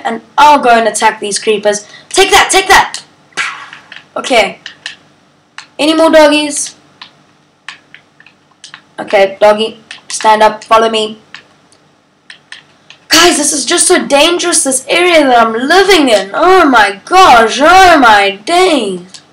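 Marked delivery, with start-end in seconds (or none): 2.1-3.0 1 playful battle cry. Real, not acted. Attacking creepers. 16.3-19.2 1 drawn-out exasperation. Real, not acted. Dangerous area, drawn out.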